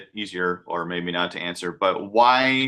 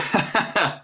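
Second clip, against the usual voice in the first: short laugh; 11 semitones higher than usual. Real, not acted. Laughs at the fantasy idea.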